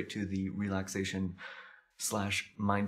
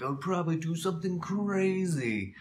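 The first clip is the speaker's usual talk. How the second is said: higher-pitched, quicker imagined musing; mock daredevil thought; 9 semitones higher, 4 dB louder than usual. Telling viewers about imagining his last day, mock daredevil thought. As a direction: toss it out as a wild idea, pitch raised. Acting out his past thinking.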